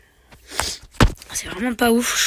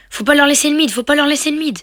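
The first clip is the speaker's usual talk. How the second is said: higher, louder urgent warning; urgent call; 6 semitones higher, 7 dB louder than usual. Real, not acted. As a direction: shout the order to hold the mid. Urgent call during tense game. (crie l'ordre, plus aigu et plus fort)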